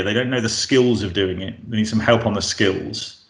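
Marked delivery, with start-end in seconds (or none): none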